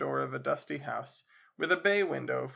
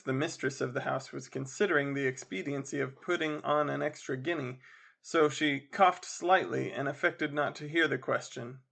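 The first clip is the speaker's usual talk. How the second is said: plain reading voice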